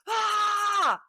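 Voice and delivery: high, loud mock yell; a sudden hammed-up yell to scare horses. Lads chasing riders through the hedge, high mock yell.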